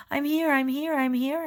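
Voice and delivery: desperate calling. Acted. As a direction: call out, pleading to be heard. Calling out unheard.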